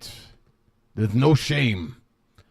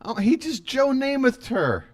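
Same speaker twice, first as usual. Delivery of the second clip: high shocked exclamation; 11 semitones higher, 5 dB louder than usual. Real, not acted. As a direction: gasp it out in disbelief. Shocked by the kiss.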